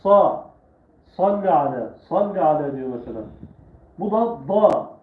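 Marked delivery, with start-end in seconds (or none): 0.0-0.5 Sa said thick and loud, tongue pulled back; heavy sa. Shown as a demonstration. Teacher demonstrating the thick Arabic letter sa. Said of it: "Biraz daha kalın".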